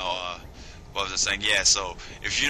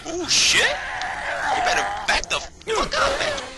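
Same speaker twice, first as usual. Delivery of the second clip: very high alarmed shout; high-pitched panic; real startled yelp; much slower than usual. Real, not acted. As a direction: yell a frightened warning at an attacker. Startled by attacker in game.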